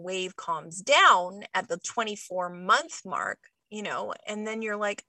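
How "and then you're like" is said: ordinary delivery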